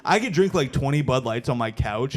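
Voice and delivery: normal talking voice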